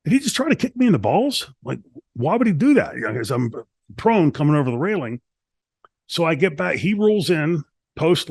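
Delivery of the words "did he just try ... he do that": much higher, puzzled question; baffled, can't believe the kick; incredulous thought. Retold or imitated. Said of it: "I'm thinking".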